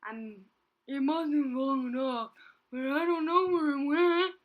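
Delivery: loud sobbing rhino; crying. A narrator's reading. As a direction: sob it out loud. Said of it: "he cried".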